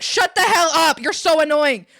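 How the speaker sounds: loud, high angry shout; re-enacted furious outburst; retold scream. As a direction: yell it at them in exasperation. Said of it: "I just, like, screamed at them".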